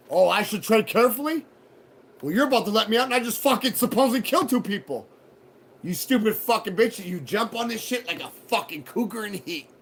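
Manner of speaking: loud, heated retelling of cursing the judge; retold angry rant. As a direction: throw the insults back at her again, loudly.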